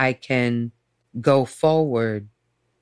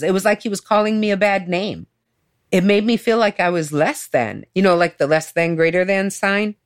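ordinary talk

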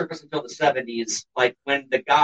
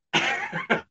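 short high scoffing laugh; 5 dB quieter than usual. Real, not acted. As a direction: laugh it off in disbelief. Laugh after Bullshit.

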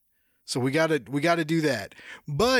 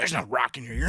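low mom voice; 8 semitones lower, 3 dB quieter, faster than usual. Retold or imitated. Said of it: "She's like".

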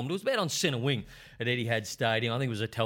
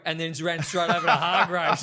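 laughing; 5 semitones higher, 5 dB louder than usual. Real, not acted. Laughs at end of brawl story.

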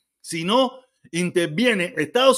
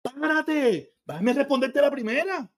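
higher, insistent stop; halting a rapid-fire questioner, higher and insistent; firm interruption; 6 semitones higher, much slower than usual. Retold or imitated. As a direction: cut him off, pitched up and insistent. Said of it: "te digo".

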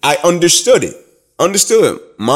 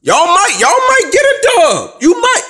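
defiant taunt; 11 semitones higher than usual. Retold or imitated. Taunting the rival teams.

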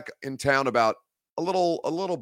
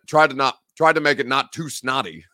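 ordinary talk

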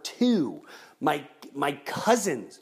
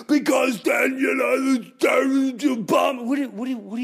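agitated shout; 10 semitones higher, 5 dB louder than usual. Retold or imitated. Imitating an agitated worrier.

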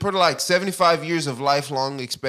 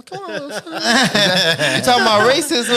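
slow, laughing, incredulous question; laughing disbelief; 8 semitones higher, 7 dB louder, slower than usual. Real, not acted.

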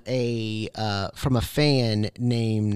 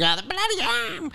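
put-on high, soft eerie wail in a New England accent; spooky mock wail; 13 semitones higher, 5 dB quieter than usual. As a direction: moan it like a ghost story in the car. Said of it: "as a family driving".

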